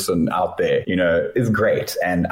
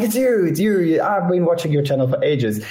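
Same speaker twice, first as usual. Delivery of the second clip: high, thrilled retold fan voice; excited fan; 9 semitones higher than usual. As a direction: blurt it out like a starstruck fan. Fan recognizes him, retold.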